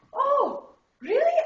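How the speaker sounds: high, bright-eyed disbelief; delighted surprise. Acted. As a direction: gasp it out, thrilled. Nun thrilled at second chance.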